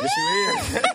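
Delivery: high playful yell of insistence; playful shout. Real, not acted. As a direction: shout it up high to egg him on.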